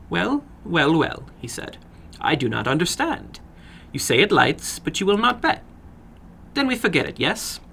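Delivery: put-on sly older gentleman with an accent; probing the boy, a touch sly; foreign little man. Narrator voices the little man.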